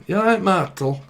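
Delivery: imitating grandma's northern greeting; lightly mimicked northern grandma; grandma's northern accent. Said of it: "My grandmother keeps calling her son Martel".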